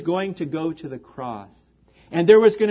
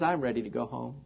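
softly, quietly, worn-out; weary, unwell; 7 semitones lower, 10 dB quieter, faster than usual. Retold or imitated. Quoting a sick friend's weary words, quietly. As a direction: say it like a tired, ailing old man ready to rest. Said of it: "when he was really down and he wasn't feeling good".

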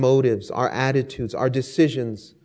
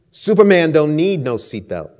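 a cocky, louder snap; snapped boast; 3 semitones higher, 7 dB louder than usual. Acted. The boxer brushing off the flight attendant, cocky snap. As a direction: toss it off proudly. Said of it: "he snapped".

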